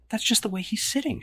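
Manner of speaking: exasperated, high and weary. Acted. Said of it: "she says, exasperated".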